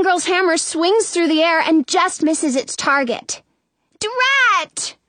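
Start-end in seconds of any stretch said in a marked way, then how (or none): 4.0-5.0 high, frustrated wail. A narrator's reading. Disguised queen misses with the hammer, wailing. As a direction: wail it out, pitch leaping up. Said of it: "the young girl wails".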